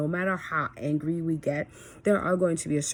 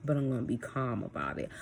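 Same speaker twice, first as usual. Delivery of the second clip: lower, softer, composed voice; calm; 4 semitones lower, 5 dB quieter, faster than usual. Shown as a demonstration. Mom promising to keep her temper with son, calm. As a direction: bring it down and stay composed.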